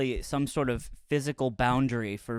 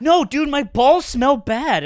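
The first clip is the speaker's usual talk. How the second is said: mock complaint; 9 semitones higher, 7 dB louder, slower than usual. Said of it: "He was like".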